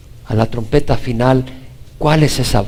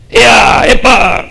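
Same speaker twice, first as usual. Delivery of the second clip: high loud call to horses; coachman shout; 9 semitones higher, 8 dB louder, much slower than usual. Shown as a demonstration. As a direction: yell at the horses to halt. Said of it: "en forma de grito". (grito fuerte de cochero a los caballos)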